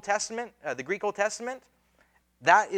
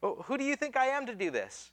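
high-pitched mock self-doubt; imitated protesting Moses; 7 semitones higher than usual. Moses making excuses to God, high mock protest. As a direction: protest your unfitness, pitched up. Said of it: "he goes on and says".

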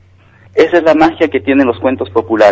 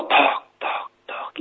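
soft, voiceless receding knocks; hollow fading "toc"; stone knocking; 11 dB quieter than usual. Acted. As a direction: imitate a pebble bouncing into the depths. Imitates stone falling in tunnel.

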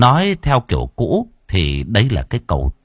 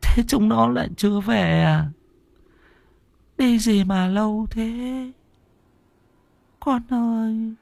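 put-on dazed old mother; 13 semitones higher, 4 dB quieter than usual. Confused mother asks why the children aren't home, high and soft. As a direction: drift off in confused grief.

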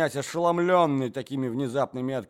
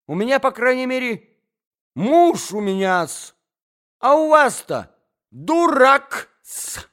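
высокая громкая визгливая перебранка; бранись взахлёб, визгливо; визгливая брань; 13 semitones higher, 11 dB louder than usual. Acted. Ссора двух дам. (дама визгливо бранит соперницу)

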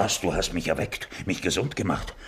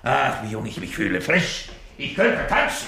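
giddy; 5 semitones higher, 4 dB louder than usual. Acted. Old man feeling young.

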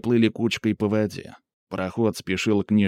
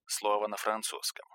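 much softer bracketed note; quiet aside; 11 dB quieter than usual. A narrator's reading. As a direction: drop the voice for a reader's note. Reader's aside replacing foreign word. (тихая ремарка в сторону)

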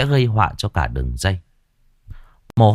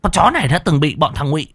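high, shrill sudden realization; much higher, rushing triumphant accusation; excited; 11 semitones higher than usual. Acted. Woman recognizes escaped captive.

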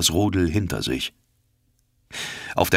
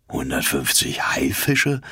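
awed and doubtful; 5 semitones lower than usual. A narrator's reading. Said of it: "zwischen Ehrfurcht und Misstrauen schwankend".

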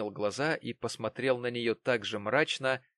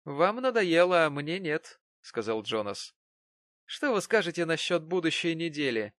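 stubborn Jonas; 6 semitones higher, 4 dB louder than usual. A narrator's reading. Jonas stubbornly proposes next week. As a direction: press on stubbornly.

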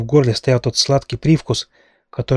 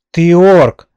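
high, loud accusing yell; enraged shriek at the redhead; furious yell; 6 semitones higher, 10 dB louder than usual. A narrator's reading. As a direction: scream the accusation at him. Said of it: "заорала я".

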